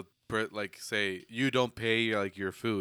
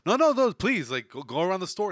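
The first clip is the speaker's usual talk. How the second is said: insistent urging; 9 semitones higher, 6 dB louder than usual. Acted. Acted shoe clerk urging a customer.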